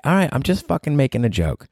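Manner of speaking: normal talking voice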